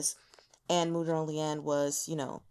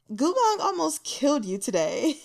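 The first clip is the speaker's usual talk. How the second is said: reading out her own note; 8 semitones higher, 5 dB louder than usual. Retold or imitated. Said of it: "I have a note here from my first read that says".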